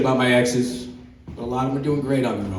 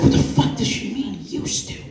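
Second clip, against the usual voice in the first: put-on girlfriend's voice; 9 semitones higher than usual. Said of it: "She's like".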